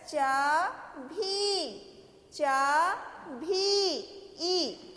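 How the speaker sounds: चाभी very slowly, holding भी; held long vowel. Shown as a demonstration. Teacher modelling चाभी for children, vowels held long. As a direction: break चाभी in two and stretch the final ee. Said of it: "देर तक बोल रहे हैं".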